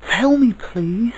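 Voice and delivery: put-on high, loud pleading child; child crying out. A narrator's reading. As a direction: beg urgently in a child's high voice. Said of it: "cried the child".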